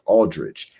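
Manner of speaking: ordinary talk, nothing marked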